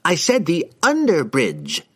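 slow, high, insistent correction; exasperated cry. A narrator's reading. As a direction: call out to stop him. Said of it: "cried Harkle".

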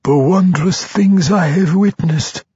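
high, excited telepathic voice. A narrator's reading. Mind flayer sharing wonders with the Archmage, excited. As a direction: exclaim in wonder, pitched well up. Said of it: "had been offered with excitement".

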